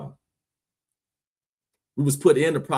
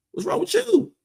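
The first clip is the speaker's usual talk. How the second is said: much higher, incredulous squeal; shrill exasperated cry. Real, not acted. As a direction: shout it in disbelief. Sudden outburst at viewer.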